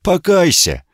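high, clear commanding call. Acted. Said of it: "тем же ясным голосом".

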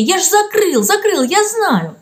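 high, insistent outcry; cry out. A narrator's reading. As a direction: cry it out in protest. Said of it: "вскрикнул Матвей". (вскрикнув)

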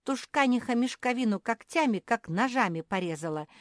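high, loud grievance; indignant complaining. A narrator's reading. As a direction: tattle loudly in outraged protest. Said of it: "хором стали жаловаться".

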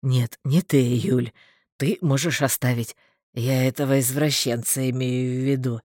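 deep, level male voice; lightly voiced man. A narrator's reading. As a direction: speak low as the injured man. Injured man speaks, eyes closed.

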